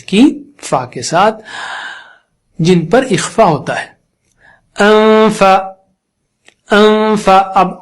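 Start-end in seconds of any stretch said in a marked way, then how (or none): 4.8-7.4 انفا with a held nasal hum before فا; انفا drawn out on a level note; nasal ghunna. Shown as a demonstration. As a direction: hum through the nose with lip ready on teeth, then release the فا of انفا. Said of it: "اس دوران ناک سے غنہ کر رہا ہوں".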